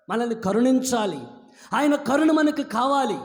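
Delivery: high, loud preaching cadence; fervent preaching. Acted. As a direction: proclaim it to the whole church. Preaching at full force.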